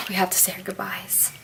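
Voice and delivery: soft unvoiced whisper so as not to wake her; whispered goodbye. Real, not acted. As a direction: whisper it quietly beside the sleeping pig. Pig sleeping nearby, whispering.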